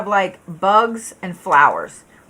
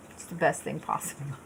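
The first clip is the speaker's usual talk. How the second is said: whispered low aside; breathy secret between friends; 6 semitones lower, 16 dB quieter than usual. Real, not acted. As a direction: drop to a conspiratorial whisper. Sly aside after petty advice.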